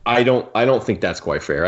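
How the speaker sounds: ordinary talk, nothing marked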